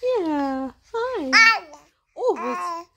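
high, loud cooing hi. Real, not acted. Cooing at baby.

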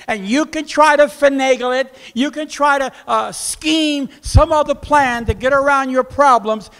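high, loud sustained warning; forceful preaching. Acted. Preacher warning listeners against scheming round problems, loud and high.

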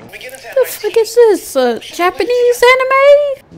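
high, disbelieving questions; baffled, can't believe it. Real, not acted. Baffled by anime scene.

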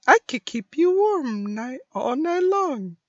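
high, sweet-talking character voice; Mei. Acted. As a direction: pitch it up and stretch the ending. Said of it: "stupid for Mei to say".

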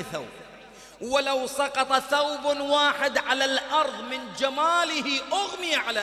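slow, loud, soaring description; full-force preaching. Real, not acted. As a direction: lift the crowd with wonder. وصف الحور العين بحماس وعظي. (وعظ متحمس بصوت عالٍ)

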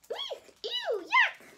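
very high squeaky eek, ew, yuck; disgusted squeals. Acted. Fussy bear Bruce recoils from gosling mess, high squeals.

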